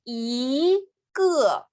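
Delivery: Y gliding up, ge dropping down; rise then fall. Shown as a demonstration. Teacher drilling tones, Y rises and ge falls. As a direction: lift "Y", then let "ge" fall. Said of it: "sube y baja".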